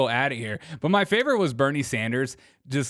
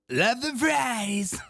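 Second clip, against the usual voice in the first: slow drawn-out Trump voice, pitched up; Trump impersonation; 8 semitones higher, slower than usual. Retold or imitated. Host doing his Trump bit, slow and higher. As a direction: lean into a lazy Trump drawl. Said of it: "can't do my Trump impersonation without screaming".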